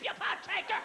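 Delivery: "you pot taker" yelled high, laughing; high hammed-up yell of "you pot taker"; mock scream. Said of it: "He calls me screaming".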